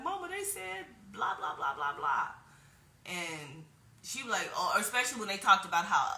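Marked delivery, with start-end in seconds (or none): 0.0-2.3 put-on high whiny kid voice telling on the other kids; childish complaint. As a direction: whine it to mama like a hurt little girl. Whining to mom as child.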